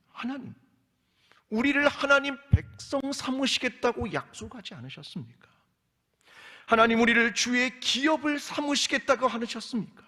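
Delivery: high, loud pleading to God; impassioned plea. Acted. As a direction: preach the plea at full force, voice lifting. Preacher pleads as the people. (하나님께 외치듯 높고 힘찬 탄원)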